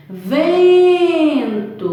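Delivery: "Vento" with a long, loud, high VEN; almost sing out the VEN in "Vento"; stress on VEN. Shown as a demonstration. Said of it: "vem é a sílaba tônica".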